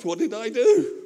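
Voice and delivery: a hint of sudden fear at his own words; mock panic. Preacher recalling his panic after a bold claim, mock alarmed.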